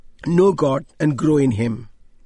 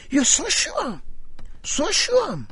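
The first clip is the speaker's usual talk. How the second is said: amazed admiration; 10 semitones higher, slower than usual. Retold or imitated. Vicar marvels at preacher.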